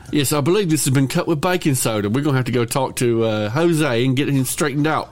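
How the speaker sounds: mock-British gangster, proper and earnest; British accent. Cohost playing along as a British-accented gang member. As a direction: answer in a put-on British accent, laugh near the end. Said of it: "speaking with British accents".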